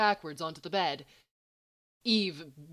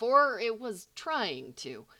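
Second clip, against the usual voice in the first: usual speaking voice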